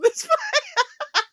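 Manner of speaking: big high laugh into the words; laughing it all off. Real, not acted. Laughing off her own story.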